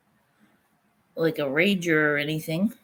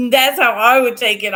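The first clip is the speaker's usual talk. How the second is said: normal talking voice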